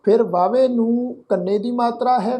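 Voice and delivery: usual speaking voice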